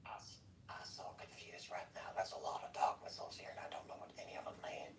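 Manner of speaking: hushed, baffled whisper. Real, not acted. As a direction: whisper it like a confused aside. Baffled aside about dog whistles.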